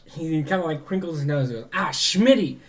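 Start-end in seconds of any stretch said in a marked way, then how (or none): 1.7-2.6 raised, higher exasperated call; annoyed captain's shout. Acted. Ferryman captain calls to his goblin deckhand, annoyed shout.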